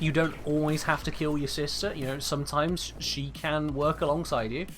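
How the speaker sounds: ordinary talk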